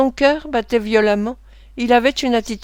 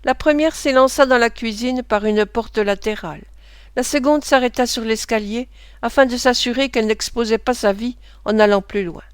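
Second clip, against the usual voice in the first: usual speaking voice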